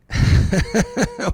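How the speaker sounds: loud, high burst of laughter. Real, not acted. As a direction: let a big laugh out. Laughs after "doing great.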